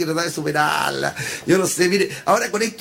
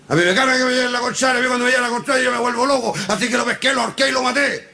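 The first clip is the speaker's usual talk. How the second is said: higher, insistent mock rage; worked-up rant; 5 semitones higher than usual. Ex-prisoner explaining why he killed, acted rant. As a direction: play a madman getting worked up.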